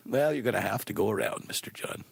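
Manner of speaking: low, soft, trailing into near-whisper; OnStar operator. Acted. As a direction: insist quietly, like an unbudging agent. Skit, operator insists to caller.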